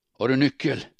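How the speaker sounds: angry shout on the way home. Acted. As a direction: bark the question at her. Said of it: "skrek han".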